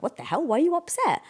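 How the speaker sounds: mock bafflement, leaping high at the end; baffled retort. Wife imitating husband's reply to her being upset, baffled. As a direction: imitate a baffled partner's question.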